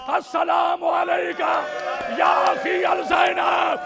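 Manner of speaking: high, loud sustained wailing oratory; full-force majlis preaching. Acted. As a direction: cry the tragedy out to the mourners.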